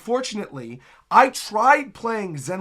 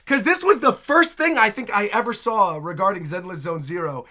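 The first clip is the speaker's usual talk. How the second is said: normal talking voice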